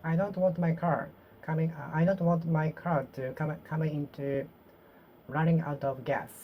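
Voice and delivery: ordinary delivery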